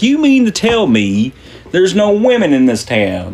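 high, indignant questioning; incredulous. Acted. Miner back at the saloon confronting bartender, incredulous. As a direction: challenge them in hammed-up disbelief.

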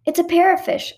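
high-pitched wonder; fascinated. A narrator's reading. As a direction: announce it with fascination. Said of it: "I said in fascination".

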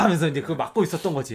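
ordinary talk, nothing marked

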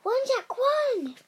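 high, urgent cry to run; urgent call. Acted. Wife urging Jack to run now. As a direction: push him out the door.

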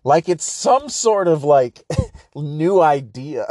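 slow, laughing mockery; broken up by laughter; laughing through it. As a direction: giggle while saying it. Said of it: "repeats the phrase".

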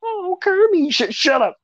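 high-pitched mock scolding of Kermie; imitating a game character. Friend redoing the game's Kermie line in a high puppet voice. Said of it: "He just imitates the game".

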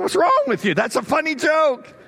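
a hammed-up cry at the audience that failed to laugh; mock indignant shout. Retold outburst at silent audience.

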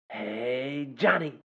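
stretched hey, then a high-pitched name; imitated kid greeting. Student repeats his running joke to Mister John, mimicked catchphrase. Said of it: "He says".

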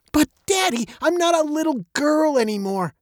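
put-on high-pitched childish protest; protesting girl. A narrator's reading. Veronica objects to being called little, protesting. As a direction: object to Daddy like a young girl. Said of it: "she said".

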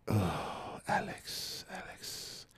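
very soft, near-whispered imitation of a customer; hushed mock pleading; coaxing customer. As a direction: sigh the name as a wheedling haggler. Imitates customer coaxing him on price.

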